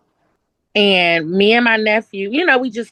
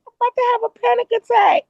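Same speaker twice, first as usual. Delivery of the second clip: high, fast, panicky voice; retold squeaky fluster; mock panic; much faster than usual. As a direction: act out rising panic, rushed. Retold panic in swarming crowd.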